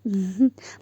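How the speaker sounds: stifled amused laugh; acted giggle at a prank; laughing hard. A narrator's reading. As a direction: try and fail to hold back a laugh. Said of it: "وهي ماتت على نفسها من كتر الضحك".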